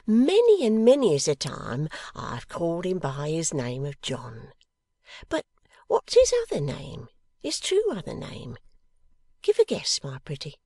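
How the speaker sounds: high, swooping voice of an excited motherly woman; fond, eager teasing; Mrs Boffin, teasing. Acted.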